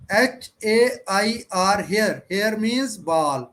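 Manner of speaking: each letter on its own; slow, clear spelling. Shown as a demonstration. Teacher showing how to study at home, slow clear spelling. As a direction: spell it slowly for learners to repeat. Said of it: "आपने ऐसे पढ़ना है".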